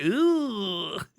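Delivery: ordinary talk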